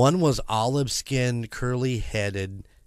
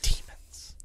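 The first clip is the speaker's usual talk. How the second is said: whisper; 4 dB quieter than usual. Acted. Ominous whispered echo.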